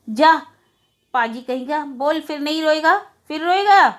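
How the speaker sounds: hammed-up angry elder brother; angry scolding. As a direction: scold the boy in a rage, rising at the end. Brother slaps and scolds child.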